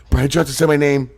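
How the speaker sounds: high, loud excited gushing, building up; giddy fanboy. Retold or imitated. Quoting himself gushing over [name], rising giddy excitement. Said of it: "I was like".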